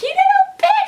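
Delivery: high, loud mock yell of the bully; imitated scream. The bully screams after her as she runs. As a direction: screech it after her like the beaten boy. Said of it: "I could hear him screaming after me".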